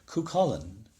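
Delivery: usual speaking voice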